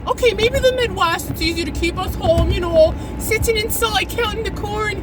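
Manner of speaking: put-on high-pitched made-up Midwest accent; hammed-up accent of no clear place; unplaceable accent. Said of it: "I don't know what accent that is".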